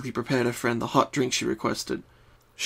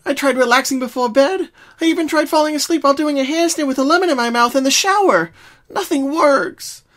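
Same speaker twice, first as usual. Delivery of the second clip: much higher, louder, desperate; distraught; 13 semitones higher, 7 dB louder than usual. Acted. Pinkie lists everything she tried, loud and desperate. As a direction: pour out the desperation.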